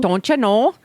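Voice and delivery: put-on light Minnesota accent; a hint of Minnesota twang. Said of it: "my terrible Minnesota accent".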